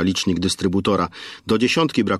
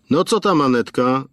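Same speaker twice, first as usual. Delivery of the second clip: cheery shopkeeper; 6 semitones higher than usual. A narrator's reading. Station owner greets Aneta at the counter, high and cheery. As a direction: greet the regular girl brightly. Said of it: "wyrwał ją głos sprzedawcy".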